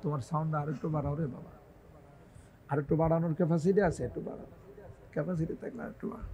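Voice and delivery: very quiet, fast aside; offhand aside. Real, not acted. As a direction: drop out of preaching for a hushed, quick word to the soundman. Aside to the sound operator. (Mic অপারেটরকে নিচু গলায় দ্রুত কথা)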